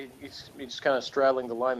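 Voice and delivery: ordinary talk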